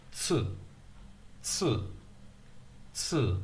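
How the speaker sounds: Tsu with a clear ts at the start; ts sound. Shown as a demonstration. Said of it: "I was taught to say this".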